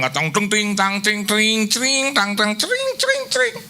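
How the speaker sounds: sing-song bell noises rising to a squeak; mock chiming. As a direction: imitate little bells ringing up the scale. Imitating bell sounds, rising.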